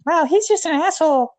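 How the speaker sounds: hammed-up scornful woman; mock women's voice. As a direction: sneer it in a high mocking voice. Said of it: "A lot of women are like".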